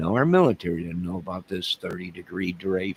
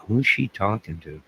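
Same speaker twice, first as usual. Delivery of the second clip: ordinary talk, nothing marked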